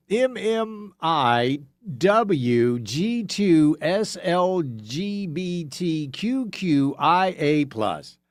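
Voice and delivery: spelled out letter by letter, drawn out; slow mock dictation. Someone writing down a strong password, slow and laborious. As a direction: dictate it very slowly, as if copying it down.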